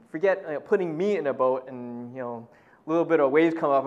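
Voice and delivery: usual speaking voice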